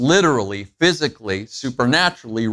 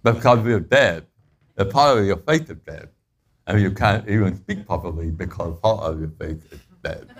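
slurred speech like after Novocaine, lower and chuckling; numb-mouth talk; 6 semitones lower than usual. Shown as a demonstration. Pastor imitating a dentist patient, numb-mouthed slurring. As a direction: talk as if half your face won't move. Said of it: "they talk like this".

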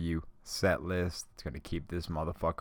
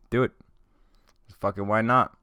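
ordinary delivery

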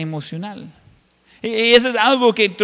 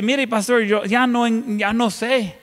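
a hint of a worn-out believer pleading, higher; desperate congregant; 5 semitones higher, 4 dB louder than usual. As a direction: plead like someone at the end of their rope. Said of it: "y la gente dice". (creyente agotado, suplicando)

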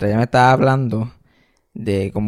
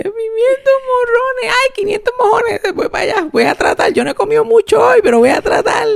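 put-on very high weepy child voice; a sobbing boy giving in; crying little kid; 4 dB louder than usual. Little boy to grandma, whimpering and tearful.

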